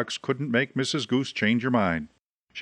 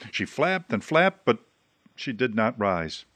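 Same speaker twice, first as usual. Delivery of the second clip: ordinary delivery, nothing marked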